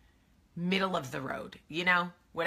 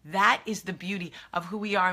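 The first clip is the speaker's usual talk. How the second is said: ordinary talk, nothing marked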